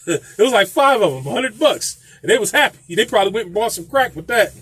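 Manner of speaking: ordinary talk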